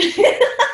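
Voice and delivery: high giggle; high burst of laughter. Real, not acted. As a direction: break into a laugh. Laughs at herself.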